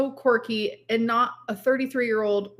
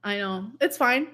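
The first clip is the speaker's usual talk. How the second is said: ordinary talk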